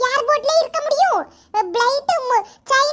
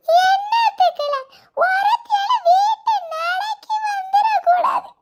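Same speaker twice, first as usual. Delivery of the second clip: slow, higher sobbing complaint, voice cracking; wailing crying; 5 semitones higher, slower than usual. Acted. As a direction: wail at being left behind, slow and high. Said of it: "அதுக்கு தான் இருந்து அழுதுட்டு இருக்கியா?".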